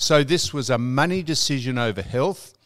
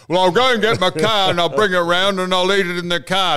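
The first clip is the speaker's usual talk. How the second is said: a louder, hammed-up Don ordering his usual; mock demanding Don; 3 semitones higher, 5 dB louder, faster than usual. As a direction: play Don insisting on his eggs, half-mocking. Retelling Don's reply to café bloke.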